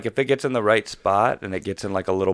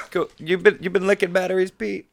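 teasing; 11 semitones higher, 3 dB louder than usual. Real, not acted. Teasing Pete about numb tongue.

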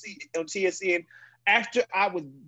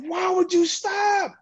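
retold yell; 12 semitones higher, 3 dB louder, slower than usual. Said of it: "is yelling at his teammate".

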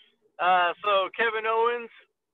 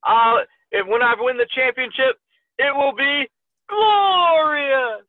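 loud, raised wrestler promo, drawn-out last word; Bobby Roode; 4 semitones higher, 4 dB louder, slower than usual. Acted. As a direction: build to a big, high proclamation. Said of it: "I can't do Bobby Roode either".

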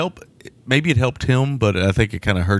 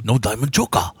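hammed-up Japanese accent, slightly raised; mock Japanese accent; 3 semitones higher than usual. Co-host jokes as the film's star, mock Japanese accent. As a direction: imitate a Japanese accent, "choker" ending in an open "ah". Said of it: "the accent".

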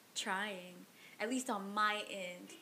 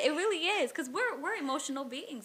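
much higher and louder, emphatic; excited agreement; 6 semitones higher, 9 dB louder than usual. Real, not acted. Host eagerly agreeing that fights are always stupid. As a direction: burst out agreeing.

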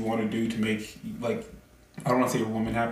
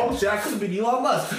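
much higher, laughing outburst; mock realization; 9 semitones higher than usual. Joking with friends about rabbit holes of what-ifs, mock realization. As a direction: blurt it high through a laugh.

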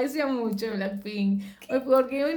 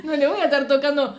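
ordinary talk, nothing marked

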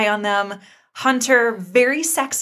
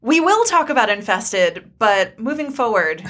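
usual speaking voice

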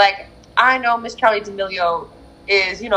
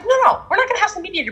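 firm refusal; 12 semitones higher, faster than usual. Retold or imitated. Retold refusal of mediator.

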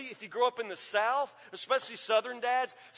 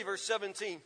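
ordinary talk

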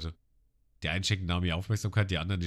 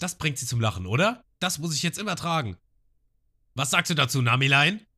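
stolzer Genzo; 7 semitones higher, 3 dB louder than usual. Retold or imitated. Genzo mit Windrad am Hut.

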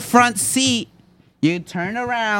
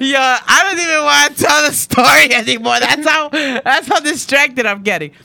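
loud, higher, laughing complaint; laughing protest; 5 semitones higher, 4 dB louder than usual. Real, not acted. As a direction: protest through your laughter, derailed. Derailed storyteller protests while laughing.

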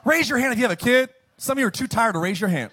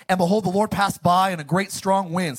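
ordinary delivery

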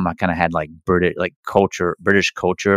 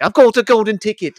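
very high, louder squeal of triumph; giddy high-pitched mock cheer; high excited mock voice; 5 dB louder than usual. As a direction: shriek it like winning a prize. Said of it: "you know, it's like".

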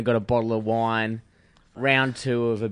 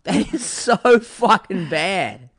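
slow, drawn-out outrage; appalled; 6 semitones higher, 5 dB louder, much slower than usual. Real, not acted. Friend reacting to the cruel breakup, slow outrage. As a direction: lean on every word, disgusted.

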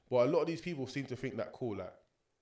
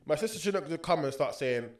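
ordinary talk

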